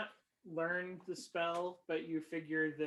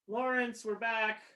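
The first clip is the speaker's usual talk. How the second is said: calling out; 6 semitones higher, 6 dB louder than usual. Acted. S D calls to Lawrence.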